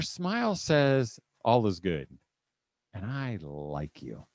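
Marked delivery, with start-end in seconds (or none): 1.4-4.3 low, soft, slowing to a hush; tender reassurance. Acted. Parent's smile speaking to a child, tender.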